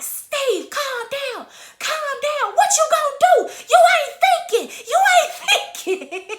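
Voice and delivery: very high, squealing mock panic; frantic pleading wife. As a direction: imitate mama desperately talking her husband down. Retold mother frantically restraining her husband.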